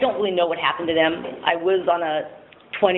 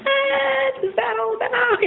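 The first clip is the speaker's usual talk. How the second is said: much higher, slow, drawn-out disbelief; baffled; much slower than usual. Retold or imitated. Reacting to the card saying God loves Joseph more, high and baffled. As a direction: stretch it out in confused wonder.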